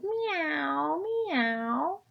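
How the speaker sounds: high rising meow, meow like a kitten; high cat meow. Acted. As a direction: mew it up high. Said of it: "And no cat said".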